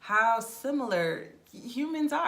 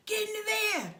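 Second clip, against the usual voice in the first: high mock kidnapper; 14 semitones higher, 3 dB louder than usual. Mock trafficker.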